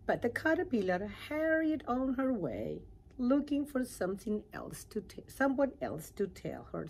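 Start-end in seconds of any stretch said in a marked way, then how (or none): none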